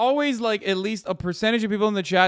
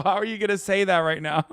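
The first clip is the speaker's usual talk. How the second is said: ordinary delivery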